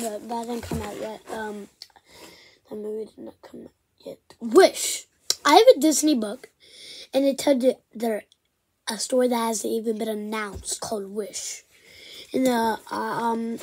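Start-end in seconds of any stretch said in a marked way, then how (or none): none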